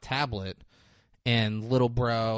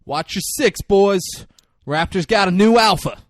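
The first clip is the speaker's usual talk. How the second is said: commanding call; 7 semitones higher, 9 dB louder than usual. Retold or imitated. Chris Pratt commanding his men.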